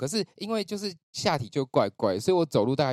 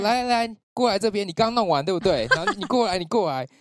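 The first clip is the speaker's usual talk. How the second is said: higher, louder nurse calling across the hall; 9 semitones higher, 7 dB louder than usual. Retold or imitated. Older nurse spots him and loudly calls him over. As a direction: beckon him loudly from far away.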